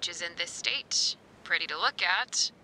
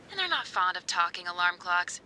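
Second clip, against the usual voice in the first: ordinary delivery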